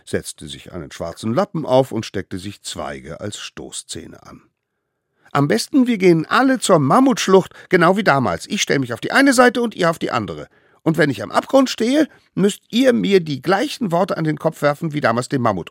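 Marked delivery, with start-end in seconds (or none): 5.3-15.7 put-on high, loud child-figure voice; eager little one giving orders; bossy little ringleader. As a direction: lay out the plan to the gang.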